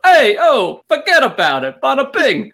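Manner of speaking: put-on high, punchy New York mafioso accent. Debater showing off New York inflection, mock mafioso accent. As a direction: call it out like a New York mobster. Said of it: "our mafioso".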